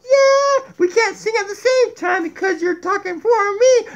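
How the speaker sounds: high, lively puppet voice; Donkey puppet voice. Acted. Puppet Donkey lecturing viewers about cats, high puppet voice.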